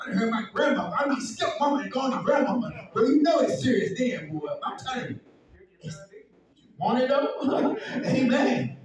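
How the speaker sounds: loud, driving preaching; fiery preaching. Acted. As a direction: preach it hard. Preaching at full force.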